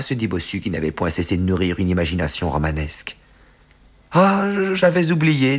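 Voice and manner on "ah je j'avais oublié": high, stammered exclamation; flustered surprise. A narrator's reading. Secretary claiming he forgot the confidence, high and flustered. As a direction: caught out, pitch leaping up.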